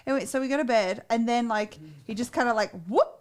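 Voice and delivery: normal talking voice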